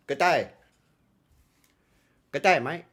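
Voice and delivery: tentative stabs at an Aussie greeting; badly faked Australian accent. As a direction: practise an Australian hello. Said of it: "The Australian accent".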